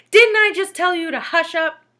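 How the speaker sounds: high, loud, strained shriek of a scold; furious teacher. A narrator's reading. Teacher losing it at Todd, high loud shriek. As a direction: lose your temper completely.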